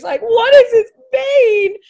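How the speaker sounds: high, loud tearful wail of panic; crying. Retold or imitated. Bawling on the phone at the diabetes news, sobbing. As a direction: let it break into a sob. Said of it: "I'm just crying and crying".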